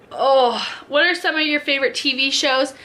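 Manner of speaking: ordinary talk, nothing marked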